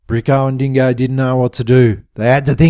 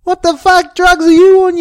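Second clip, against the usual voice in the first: loud, very high incredulous challenge; incredulous anger; 14 semitones higher, 7 dB louder than usual. A narrator's reading. Bricko challenges Diesel's accusation, incredulous anger. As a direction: throw the question out high and loud. Bricko confronts his attacker.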